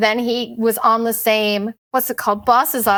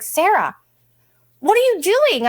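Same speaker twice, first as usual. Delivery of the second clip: much higher, shocked rebuke; imitating a friend's shocked scolding, much higher; alarmed disbelief; 9 semitones higher than usual. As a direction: sound appalled at your friend. Friend alarmed at her bathroom trip.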